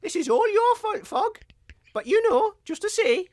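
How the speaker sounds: high, menacing storyteller voice; vengeful Jack. Acted. Jack O'Dory blaming Fog, high taunting voice. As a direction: gloat over the returning foe in a high voice.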